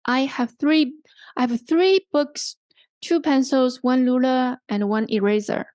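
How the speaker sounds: items held up from "three books", "eraser" dropping last; parallel list intonation. Shown as a demonstration. Teacher models a full list sentence, falling on the last item. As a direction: keep "books", "pencils", "ruler" aloft, then fall on "eraser". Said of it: "So this is the last".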